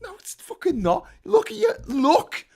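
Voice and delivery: high-pitched mock-argument outburst; exasperated rebuttal. Acted argument, rebutting a denier.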